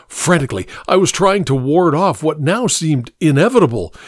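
ordinary delivery

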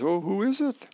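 a lightly voiced husband asking who; husband's eager question. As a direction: pop up high and eager. Husband character answering wife.

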